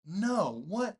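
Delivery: loud, high shocked outburst. Retold or imitated. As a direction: react in disbelief. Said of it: "I was like".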